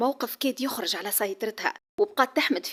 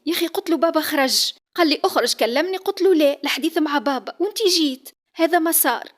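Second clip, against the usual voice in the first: agitated explaining; 6 semitones higher, 6 dB louder than usual. Acted. Daughter excitedly explains to father.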